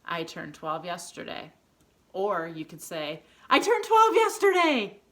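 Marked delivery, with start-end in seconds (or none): 3.5-5.0 high, loud exclamation; really excited. Shown as a demonstration. Teacher showing pupils the exclamation version, really excited. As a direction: burst out with the big news. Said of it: "Unless you're really excited about it".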